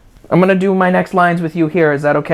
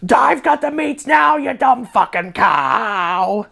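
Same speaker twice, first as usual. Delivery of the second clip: high-pitched mock scream at the cow; 8 semitones higher, slower than usual. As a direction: yell it like a madman who found his meats, slowing on the insult. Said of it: "screamed at his pet cow".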